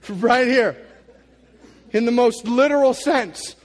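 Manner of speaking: loud, high, rising preacher's call; preaching at full force. Acted. Preacher telling the church Jesus rebukes them all, at full force. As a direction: drive it home to the congregation.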